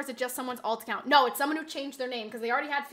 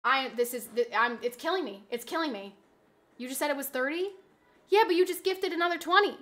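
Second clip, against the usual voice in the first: normal talking voice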